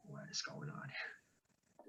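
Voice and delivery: slow, soft breathy whisper. Acted. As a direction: hush it like hiding in the dark. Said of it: "more like a whisper voice".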